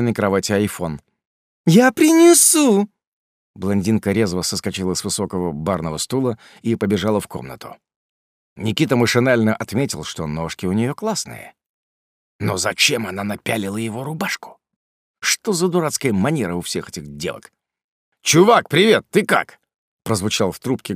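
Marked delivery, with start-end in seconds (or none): 1.7-2.9 put-on very high, loud, drawn-out girly cry; affected drawl. Barbie eagerly jumps up. 18.2-19.6 cheery greeting. Acted. Friend greets on phone.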